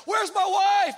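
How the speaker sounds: high, loud panicked shouting; freaking out. Retold or imitated. Injured on the beach, yelling in panic about sharks. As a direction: scream it like a drama king. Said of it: "I'm flipping out".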